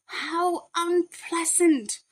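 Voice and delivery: high, slow, prim outrage; indignant pets. A narrator's reading. Pets appalled at the thieves' plan, high and slow. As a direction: draw it out high and shocked. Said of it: "And all the pets said".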